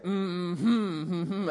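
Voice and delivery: tuneful closed-mouth hum; imitated humming. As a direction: hum the song's hook as a clue. Imitating wife humming the tune.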